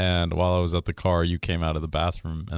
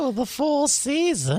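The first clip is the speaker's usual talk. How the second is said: high, slow mocking echo; high sneering imitation; mock snooty; 3 dB louder than usual. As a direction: parrot her snobbery back. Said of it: "mocking the lady's boast".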